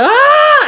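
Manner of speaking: high, loud mock scream; imitated scream. Mom imitating her hormonal child's scream, high and loud. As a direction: burst into a child's overblown shriek. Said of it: "the scream".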